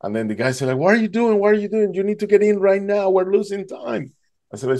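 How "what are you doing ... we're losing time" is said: a much higher, urgent boat captain calling out. Retold or imitated. Captain urging a tired swimmer aboard, high and urgent. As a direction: hurry him aboard, high and pressing.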